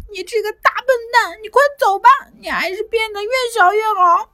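high, fast, tearful scolding; boy yelling at the monster while fixing his truck. A narrator's reading. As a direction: tell the monster off. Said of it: "一边冲着怪物叫道".

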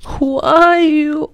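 drawn-out high breathy-free falsetto, very slow; high, slow, coy "Who are you". Retold or imitated. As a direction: mimic a sleepy coquettish girl asking who is there. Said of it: "就成这，这调儿了".

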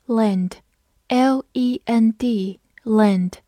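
slow, spelled letter by letter; slow spelling. Shown as a demonstration. Teacher spelling a word for students. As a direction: spell it out slowly. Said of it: "spelling the word out".

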